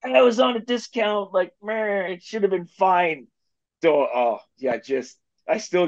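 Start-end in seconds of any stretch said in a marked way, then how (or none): 0.0-3.3 higher, whiny excuse-making voice; sheepish husband defending a bad purchase, high whiny voice; mock defensive whine. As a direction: plead your case like a sheepish buyer. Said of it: "I'm like".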